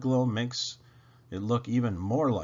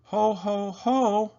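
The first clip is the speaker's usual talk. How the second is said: higher, half-hearted ho ho ho; a faked, feeble Santa cheer; weak cheer; 9 semitones higher, 5 dB louder, slower than usual. A narrator's reading. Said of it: "belts out a weak cheer".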